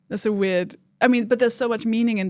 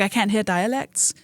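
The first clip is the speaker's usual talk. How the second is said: ordinary talk, nothing marked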